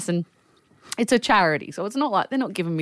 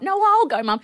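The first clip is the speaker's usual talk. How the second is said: put-on high, pitched-up teen voice; mock child's voice; 11 semitones higher than usual. Teen offering to fetch the car, high voice. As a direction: squeak it like the kid offering. Family member voiced in story.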